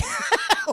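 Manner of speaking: short, high loud laugh; bright cackle of amusement; real laughter. Real, not acted. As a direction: burst out laughing at the joke. Laughing at the retold put-down.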